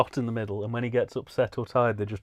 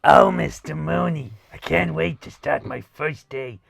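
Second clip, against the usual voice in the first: schoolgirl voice; 3 semitones higher, 5 dB louder than usual. Pretend. Mock young schoolgirl addressing teacher.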